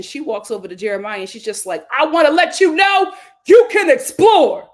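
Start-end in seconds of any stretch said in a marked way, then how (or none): 2.0-4.7 loud, pointed parting line; loud mock taunt. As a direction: toss it at him loud and smug. Huda voiced leaving him.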